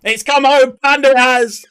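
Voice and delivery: high, loud cheer; celebratory shout. Real, not acted. Streamer telling viewer Panda it's come home, celebratory shout. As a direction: proclaim it like a winning fan. Celebrating England finally winning.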